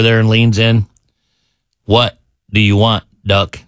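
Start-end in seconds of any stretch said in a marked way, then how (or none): 1.8-3.6 low, contained anger; mad bartender. Acted. Angry bartender leaning in at the returning duck, low and mad. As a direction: lean in with a low, measured threat. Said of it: "he's mad".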